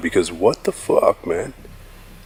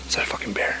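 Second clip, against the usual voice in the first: scared whisper, much softer; 9 dB quieter, much faster than usual. Real, not acted. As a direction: hush it in fear. Fearing a bear nearby.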